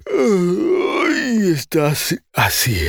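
high yawn pulled out slowly; showing the open-throat yawn, high and drawn out. Shown as a demonstration. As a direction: speak through a wide open yawn.

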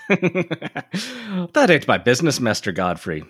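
slow, high start in stage-Irish; drawn-out caricature Irish refusal; caricature Irish accent. Acted. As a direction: decline slowly in an Irish brogue. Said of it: "nineteenth century caricatures of Irish Americans".

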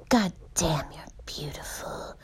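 breathy hushed sweet-talk, half whispered; hammed-up lover voice, going to a whisper; imitating a creepy match breathing sweet nothings; mock seductive suitor. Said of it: "he's like".

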